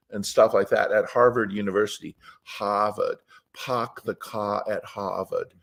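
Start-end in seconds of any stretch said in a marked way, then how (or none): 2.4-3.2 put-on dropped R Boston accent. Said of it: "I'm done with my Boston accent". 3.5-5.5 Harvard with no R, slow and soft Boston accent. Shown as a demonstration. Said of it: "I'm done with my Boston accent".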